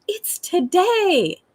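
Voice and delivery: put-on high, stretched-out child voice; excited little girl. Three-year-old greeting the dawn, excited. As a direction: imitate a toddler thrilled by daylight. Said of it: "she's so excited".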